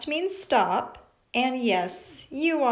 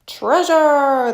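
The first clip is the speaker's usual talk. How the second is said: loud, higher shouted treasure; pirates shouting; 6 semitones higher, 10 dB louder than usual. Acted. Pirates echoing treasure, loud and eager. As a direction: bellow it like a crew. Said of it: "the pirates repeated".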